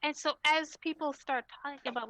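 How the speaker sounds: ordinary talk, nothing marked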